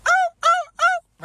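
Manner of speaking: very high yelping ow, ow, ow; hurt puppy yelps. Retold or imitated. Bully hit in the nose, whimpering like a puppy. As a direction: squeal like a kicked puppy. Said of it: "like a little puppy that got hit".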